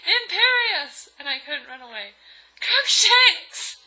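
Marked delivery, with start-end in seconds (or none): 0.1-1.1 high, loud drawn-out spell shout; Voldemort shouting. Acted. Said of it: "Voldemort shouted". 2.6-3.8 high, very loud mock battle cry; hammed-up full shout. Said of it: "I shouted at him".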